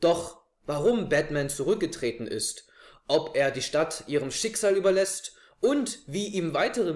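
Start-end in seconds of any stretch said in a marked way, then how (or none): none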